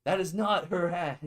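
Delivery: real outraged no, shouted at the screen; indignant shout. Real, not acted. Streamer objecting to the hat being taken, loud indignant shout.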